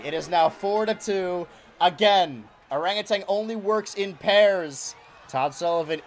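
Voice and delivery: hyped ringside play-by-play; wrestling commentator. Acted. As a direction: call the match live from ringside. Live match play-by-play.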